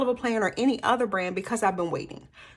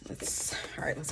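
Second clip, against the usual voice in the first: whisper; 6 semitones lower, 15 dB quieter than usual. Real, not acted. Muttering while working.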